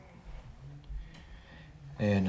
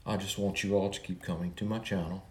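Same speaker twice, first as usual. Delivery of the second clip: normal talking voice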